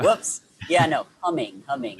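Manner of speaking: high, loud laughing whoops; giggly, flustered correction; embarrassed laugh. Real, not acted. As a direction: laugh it off, caught out by the mix-up. Embarrassed laugh at misheard word.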